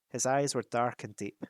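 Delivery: ordinary delivery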